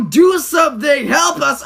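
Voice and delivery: ordinary talk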